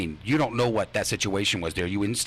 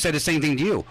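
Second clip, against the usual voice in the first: ordinary talk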